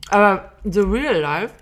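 swallowing while talking; words around a bite of food; mouth full. Real, not acted. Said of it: "während ich hier runterschlucke".

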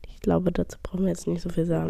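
dry whispered remark; whispering. Real, not acted. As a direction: whisper it deadpan.

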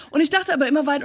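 normal talking voice

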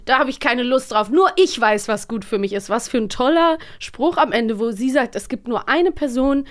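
usual speaking voice

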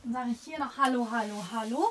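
calling back from further away, reverberant; walking away. Shown as a demonstration. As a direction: voice fading into the hallway. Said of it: "Soll ich das mal demonstrieren".

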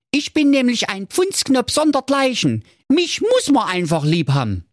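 put-on high, carefree young man calling out; Marco calling out. Marco calls out to the dwarf, carefree and high. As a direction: toss it off cockily.

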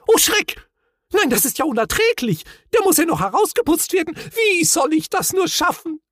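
very high, fast, frantic wailing; flustered Gafsa near tears; nervous panic. A narrator's reading. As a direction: lose your head completely. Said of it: "vor Nervosität fast in Tränen".

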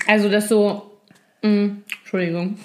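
talking around an ice cube; words muffled by ice in the mouth. Real, not acted. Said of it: "Die hat einen Eiswürfel im Mund".

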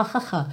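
soft breathy "ha, ha" shown for English h; breathy h sound. Shown as a demonstration. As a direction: breathe out "ha" softly. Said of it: "o H em inglês tem esse som ha". (som aspirado do h)

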